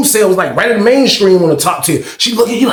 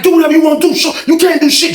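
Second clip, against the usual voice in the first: high, loud mocking taunt; imitating a cocky fighter shouting a dare; 11 semitones higher, 4 dB louder than usual. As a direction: dare him to try something. Dude taunting in retold fight.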